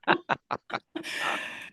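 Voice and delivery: breathy high-pitched giggle; high breathy laugh. Real, not acted. Laughs at cornering story.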